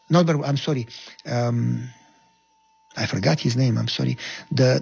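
ordinary talk

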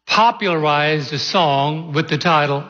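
ordinary delivery

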